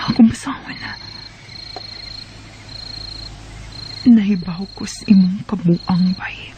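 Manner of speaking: hushed reading whisper. Acted. Wife secretly reading Ronnie's message, whispering. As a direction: read the text under your breath.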